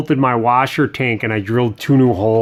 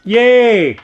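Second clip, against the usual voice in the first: excited cheer; 6 semitones higher, 7 dB louder than usual. Pretend. Mock cheer at story time.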